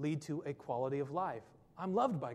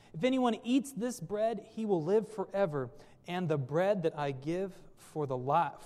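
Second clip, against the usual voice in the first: raised, earnest Bible reading; scripture reading; 4 dB louder than usual. Acted. Pastor reading Jesus' words to the church, proclaiming. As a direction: proclaim the verses to the congregation. Reads scripture passage aloud.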